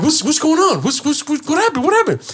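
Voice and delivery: high, frantic repeated questions; panicked questions. Retold or imitated. Son seeing his mother's bleeding arms, panicked. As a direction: beg to know what happened.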